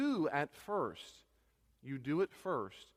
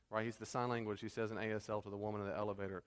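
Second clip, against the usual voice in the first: normal talking voice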